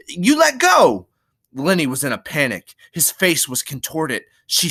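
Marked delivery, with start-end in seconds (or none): 0.1-1.1 high, drawn-out loud cry; alarmed cry. Acted. Curly's wife held by Lenny, alarmed cry. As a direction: demand release in a piercing cry. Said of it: "she cried".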